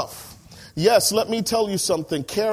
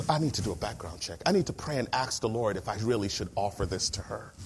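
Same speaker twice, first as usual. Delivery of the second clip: softer, lower mock hesitation; fussy excuse muttered to oneself; lightly voiced hesitant landlady; mock cautious excuse; 7 semitones lower, 8 dB quieter than usual. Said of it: "done things like".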